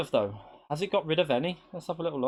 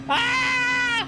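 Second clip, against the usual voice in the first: excited shout; 3 dB louder than usual. Real, not acted. Finish line tension, real shout.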